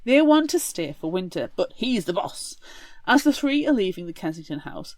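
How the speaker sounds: ordinary talk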